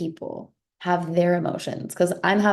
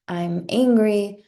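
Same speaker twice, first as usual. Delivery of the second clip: ordinary delivery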